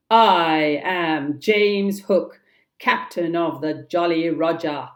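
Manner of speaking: slow, loud mock captain; imitating Hook. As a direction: proclaim it in the captain's own swagger. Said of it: "The voice replied".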